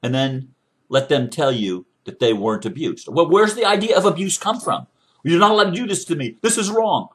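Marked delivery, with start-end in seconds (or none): 5.2-7.1 high, fast, louder outraged protest; a victim's quick, high-pitched objection. Pretend. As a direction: blurt it out like someone being hurt. Voicing an abused victim protesting.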